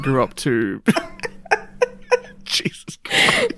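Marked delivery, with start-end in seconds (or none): 1.7-3.5 high, airy words through laughter; breathless laughing whisper. Real, not acted. Podcaster losing it at the joke, breathless laugh. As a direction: gasp it out while laughing.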